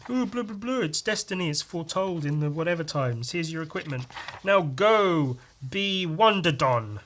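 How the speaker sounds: high mock mum voice; breezy parent sending her off.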